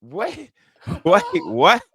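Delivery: rising disbelieving wait; wait, wait, what, climbing up; surprised. Retold or imitated. Disbelief at joke.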